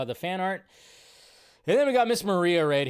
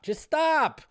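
much higher, pleading whine on "Just stop"; exasperated plea; 10 semitones higher, 4 dB louder than usual. Real, not acted. Host begging critics to drop the Barbie credit talk, exasperated high whine. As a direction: beg them to quit, fed up.

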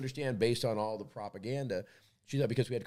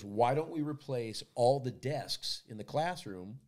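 slow suggestion; slower than usual. Retold or imitated. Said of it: "She goes".

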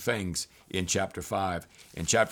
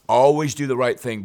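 loud, raised-pitch urging; emphatic exhortation; 4 semitones higher, 9 dB louder than usual. Real, not acted. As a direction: drive it home to the congregation. Exhorting the congregation with force.

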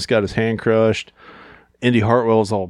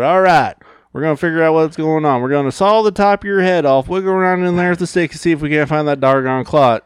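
put-on Southern accent; 7 semitones higher than usual. Southern doctor explaining brain surgery, hammed-up Southern accent. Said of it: "doctor walked in with a Southern accent".

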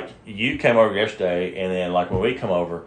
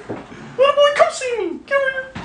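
squeaky falsetto calling the dog; high-pitched dog call. Shown as a demonstration. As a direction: call your little dog over way up high. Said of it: "kind of a high pitched".

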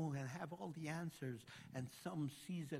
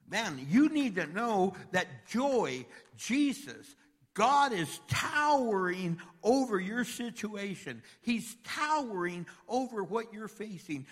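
full-force preaching; 6 semitones higher, 10 dB louder than usual. Acted. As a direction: thunder it over the congregation.

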